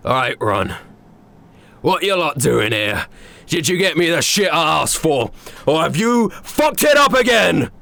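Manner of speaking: a cockney chef losing patience; gruff chef. Acted. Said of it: "the man sharpening the knives goes".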